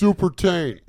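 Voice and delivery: high, loud, slow movie title shout; announcer shout. Pretend. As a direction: bellow the title like a trailer.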